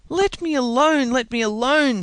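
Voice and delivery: high pleading sobbed words; sobbing plea. A narrator's reading. Catherine begging Heathcliff to leave her, sobbing. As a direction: beg to be left alone through sobs. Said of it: "sobbed Catherine".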